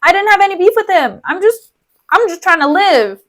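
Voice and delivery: high, rushed, protesting bro voice; Cassio. Retold or imitated. Cassio defending himself to Iago, high hurried protest. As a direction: plead your case fast and high. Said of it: "Cassio's just like".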